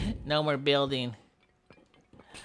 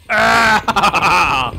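loud, long yell breaking up; juddering loud battle cry; triumphant yell; 3 semitones higher, 10 dB louder than usual. Pretend. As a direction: yell as the building comes down. Pulling the building down.

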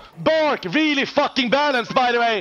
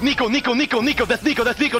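usual speaking voice

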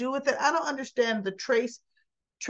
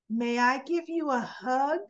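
put-on higher, careful child's question; lightly imitated little girl, pitch lifted; polite little girl; 5 semitones higher than usual. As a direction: ask sweetly like a well-mannered child. Little Violet asks politely.

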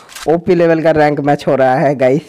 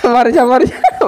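laughing taunt; 9 semitones higher, slower than usual. Real, not acted. Laughing taunt at fleeing enemy.

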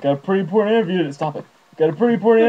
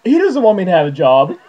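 normal talking voice

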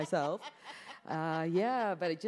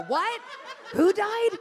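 loud, high shouted question; deaf aunts yelling; 10 dB louder, slower than usual. Retold or imitated. Older aunts who can't hear, calling out loud and high. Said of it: "they go".

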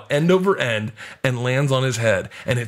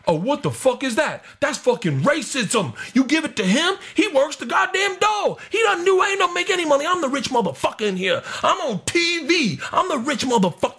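very high, outraged comic rant; hammed-up tantrum in a high, put-on voice; Tracy Morgan flipping out. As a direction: squawk in mock indignation, climbing higher as you go. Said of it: "Tracy Morgan flips out".

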